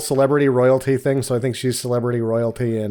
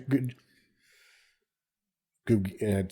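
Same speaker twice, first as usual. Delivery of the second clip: ordinary delivery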